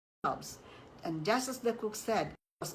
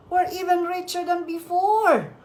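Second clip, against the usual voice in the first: high, loud gloating; gleeful; 10 semitones higher, 8 dB louder than usual. A narrator's reading. Rich husband gloating over gold, high loud glee. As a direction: crow over the riches.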